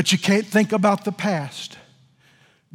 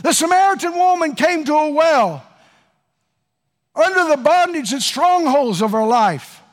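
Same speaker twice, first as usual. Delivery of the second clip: high, loud preacher cadence building to a shout; revival-style preaching; fervent preaching; 10 semitones higher, 4 dB louder than usual. Acted. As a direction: preach it to the whole congregation. Preaching at full force.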